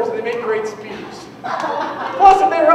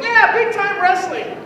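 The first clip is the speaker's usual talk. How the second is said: put-on 1 kid excitement; 9 semitones higher, slower than usual. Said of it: "I was like".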